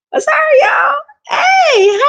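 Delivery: high squealing laugh through a sorry; giggling, sorry, y'all; laughing apology. Real, not acted. Laughing apology after name mix-up.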